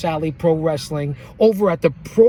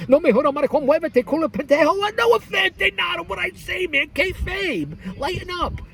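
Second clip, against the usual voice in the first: much higher, hammed-up Spanish-accented rant; 12 semitones higher than usual. Host joking about Mexican wrestling in a mock accent.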